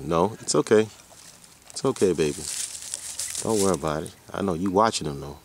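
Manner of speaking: usual speaking voice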